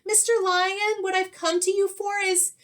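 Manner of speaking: high, polite little squeaky voice; timid mouse voice. Acted. Mouse politely waking the sleeping lion, high and timid. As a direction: nervously wake a sleeping giant.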